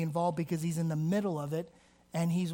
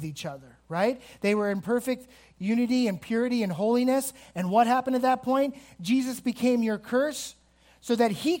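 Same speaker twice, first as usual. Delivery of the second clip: raised, driving sermon voice; fiery preaching; 3 semitones higher, 5 dB louder than usual. Real, not acted. Preacher to congregation, driving home the cross, raised voice. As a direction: press the point home to the congregation.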